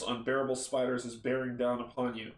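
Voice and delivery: usual speaking voice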